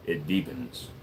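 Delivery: slow and deep, dropping low. Shown as a demonstration. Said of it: "When I go slowly".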